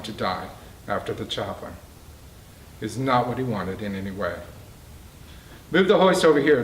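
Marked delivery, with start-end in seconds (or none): 5.7-6.6 loud call down from the ladder; yelling. A narrator's reading. Captain yelling down to the group below, loud. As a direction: shout it down to the crew. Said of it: "the captain yelled down to the group".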